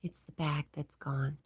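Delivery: hushed ASMR-like murmur; whisper. Real, not acted. Streamer whispers chat messages to viewers.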